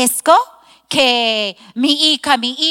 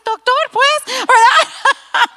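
laughing; 11 semitones higher, 5 dB louder, much slower than usual. Real, not acted. Laughing at doctor's compliment.

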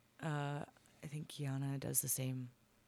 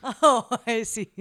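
laughing; 8 semitones higher, 14 dB louder than usual. Real, not acted. Laughing at the dice table.

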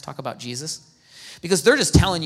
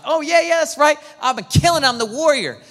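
loud, higher mock bravado; cocky boast; 8 semitones higher, 7 dB louder than usual. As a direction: play Gideon as a swaggering hero who thinks he's got it. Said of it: "Gideon would just respond like".

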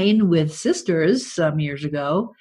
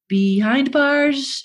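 slow punchline; 7 semitones higher, much slower than usual. Pretend. Punchline slowed.

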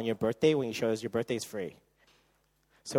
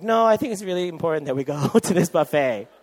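insistent; 5 semitones higher, 10 dB louder than usual. Retold or imitated. Boyfriend insisting on the planned surprise.

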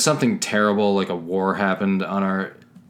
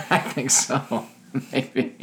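higher laughing reply; 5 semitones higher, 3 dB quieter, much slower than usual. Real, not acted. Cohost laughing while agreeing she is a nihilist. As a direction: chuckle through the words.

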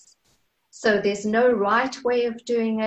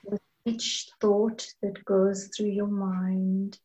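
slow, soft meditation voice with long pauses; guided meditation; 4 dB quieter, slower than usual. Acted. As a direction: ease them gently into calm, drawing out the words.

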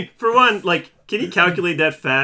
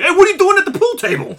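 goofy gangster; 9 dB louder, faster than usual. Retold or imitated. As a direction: play a cartoonish goon, laughing. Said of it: "the Goomba gangsters".

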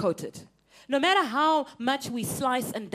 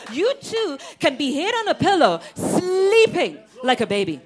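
peaceful sleep; 4 semitones higher, 8 dB louder than usual. Acted. Preacher paints peaceful sleep.

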